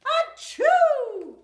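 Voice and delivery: loud high sneeze; big sneeze. Acted. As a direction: sneeze it out hard. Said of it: "makes the bear".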